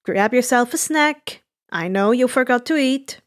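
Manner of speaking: plain reading voice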